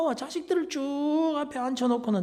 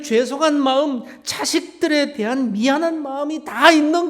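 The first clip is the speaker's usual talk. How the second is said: louder, raised-pitch sermon delivery; forceful preaching; 5 semitones higher, 9 dB louder than usual. Real, not acted. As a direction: drive the message home to the congregation.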